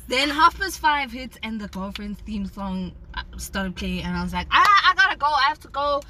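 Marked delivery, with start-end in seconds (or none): 4.5-6.0 high, loud burst of acted panic; flustered excuse. Retelling the escape from an older date, mock flustered. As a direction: blurt it out, desperate to get away.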